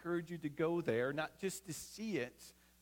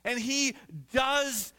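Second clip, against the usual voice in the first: louder, rising preacher's voice; full-force preaching; 5 semitones higher, 6 dB louder than usual. Acted. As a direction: drive it home to the congregation.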